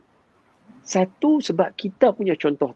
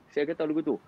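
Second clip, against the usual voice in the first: ordinary talk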